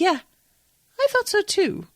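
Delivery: a prim nun agreeing with herself; smug Sister Michael. Retold or imitated.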